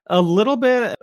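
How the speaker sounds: high, reluctant admission; hesitant. Real, not acted. Guest admitting to pushing past introversion, hesitant. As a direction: admit it hesitantly, pitched well up. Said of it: "I say that kinda hesitantly".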